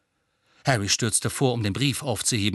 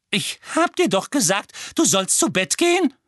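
sharp scolding; 10 semitones higher, 4 dB louder than usual. Acted. Petunia snaps at Harry.

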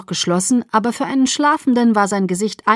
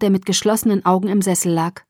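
ordinary delivery

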